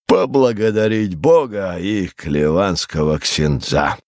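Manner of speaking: slow, grandiose after-dinner oration; theatrical philosophising rogue. Acted. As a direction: hold forth with drawn-out, swooping phrases. Voices Tyburtsiy holding forth over dinner.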